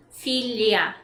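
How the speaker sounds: Filha said as "fil-ya", the lh like a y; filha with lia. Shown as a demonstration. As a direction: Filha को "फ़िल्या" बोलो, lh को y जैसा. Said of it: "Just imagine the H is an I or a Y".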